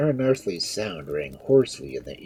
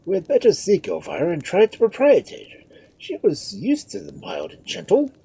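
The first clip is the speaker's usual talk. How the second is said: high, raised lordly voice; opining lord; 6 dB louder than usual. A narrator's reading. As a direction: offer counsel in a high, important voice. Lord gives counsel.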